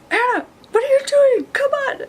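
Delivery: high-pitched, whiny imitation of pleading; hammed-up frantic protest; mock exasperated plea. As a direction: play up the exasperation, voice shooting way up.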